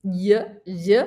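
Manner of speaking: y, y voiced as the sh or zh sound of the double L; y sound demo. Shown as a demonstration. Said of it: "la doble L en español va a tener sonido de".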